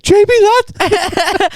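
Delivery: high, drawn-out fan call; squealing slow greeting; excited call. Retold or imitated. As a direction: shout his name like a starstruck fan. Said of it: "I was like".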